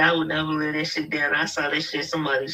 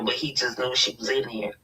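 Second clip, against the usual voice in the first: ordinary talk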